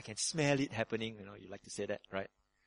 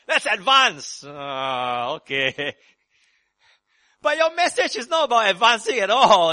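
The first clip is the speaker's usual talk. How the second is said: laughing, then a much louder, higher shout; rallying shout; 12 semitones higher, 16 dB louder, slower than usual. Real, not acted. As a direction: shout the crowd awake with a laugh. Preacher rallying a sleepy congregation.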